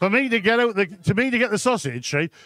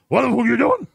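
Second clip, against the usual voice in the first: louder and higher through a hot chip; garbled like Scooby-Doo; mouth full of hot chip; 4 semitones higher, 4 dB louder than usual. Retold or imitated. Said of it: "I had a hot chip in my mouth".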